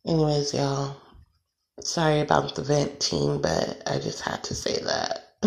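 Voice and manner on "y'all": usual speaking voice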